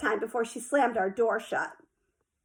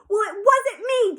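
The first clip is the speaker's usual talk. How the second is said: yelled; 10 semitones higher, 4 dB louder than usual. A narrator's reading. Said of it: "Jane yelled".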